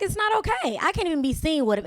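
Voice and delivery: normal talking voice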